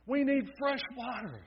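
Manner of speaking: high pleading cry for fresh water. A narrator's reading. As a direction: beg for water, pitched high. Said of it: "cried out in their weak voices".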